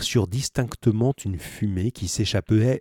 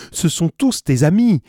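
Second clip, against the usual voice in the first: crying out; 8 semitones higher, 7 dB louder than usual. A narrator's reading. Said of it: "s'écria Penellan".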